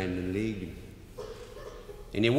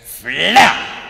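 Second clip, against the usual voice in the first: loud smack; 6 semitones higher, 12 dB louder than usual. Retold or imitated. Ball hitting with a sound.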